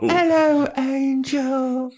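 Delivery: very high, drawn-out mock accent; Drusilla's accent. Shown as a demonstration. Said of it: "That's what she sounds like".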